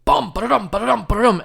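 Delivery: high, loud rhythmic bum ba-da-dum; mimicked thumping noise. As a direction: beat it out like a rattling thud.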